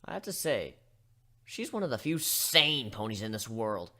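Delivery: plain reading voice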